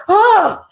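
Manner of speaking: loud, high gasp of fright; reenacted startled gasp. Waking up with plane terrors, reenacted gasp. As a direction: jolt awake with a sharp, high gasp. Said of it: "I'll wake up with, like, plane terrors".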